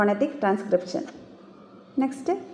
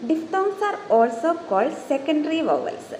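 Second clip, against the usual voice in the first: very slow, deliberate textbook reading; dictating a definition slowly; slow dictation read; 6 semitones higher, much slower than usual. Shown as a demonstration. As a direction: read each word out for note-taking. Reads English definition slowly.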